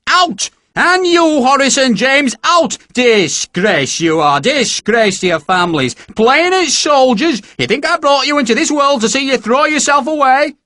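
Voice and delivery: high, loud, slow furious tirade; an angry mother berating the recruits; screaming in rage. Acted. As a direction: scold them like an outraged mother. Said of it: "screaming in rage".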